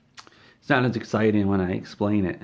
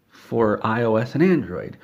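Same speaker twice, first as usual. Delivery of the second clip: ordinary talk, nothing marked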